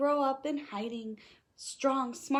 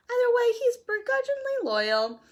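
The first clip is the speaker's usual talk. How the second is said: high fussy robot butler, a bit louder; begrudging droid voice; 12 semitones higher, 6 dB louder than usual. Acted. Narrator reading C-3PO's rhyme in a fussy droid voice. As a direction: grumble it like a put-upon droid.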